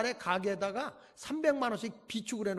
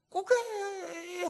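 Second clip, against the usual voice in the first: put-on whiny old lady; 12 semitones higher, much slower than usual. Imitating whining old lady.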